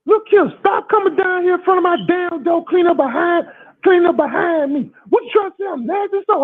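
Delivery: high-pitched mock scolding, far above your own voice; angry woman neighbour. Voices neighbour woman yelling in argument.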